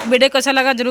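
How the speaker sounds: usual speaking voice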